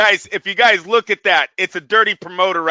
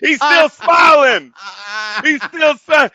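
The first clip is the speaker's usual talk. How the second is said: high, loud, slow laugh-cry shout; 5 semitones higher, 4 dB louder, slower than usual. Real, not acted. Cracking up at the hospital photo, shouting through laughter.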